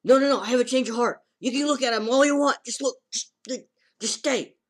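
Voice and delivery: high, loud and fast pleading; hammed-up frantic insistence; frantic girl. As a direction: rush to keep him there. Girl stops him leaving.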